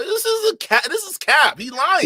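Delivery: high, loud "cap" accusation; shouting in disbelief; outraged callout. Real, not acted. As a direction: yell that it's all cap. Calling out the story.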